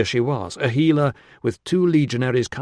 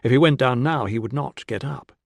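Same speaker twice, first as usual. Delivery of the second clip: plain reading voice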